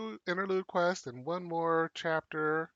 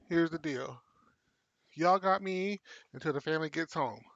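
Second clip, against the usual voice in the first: normal talking voice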